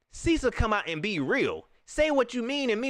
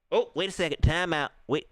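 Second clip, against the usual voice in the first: ordinary delivery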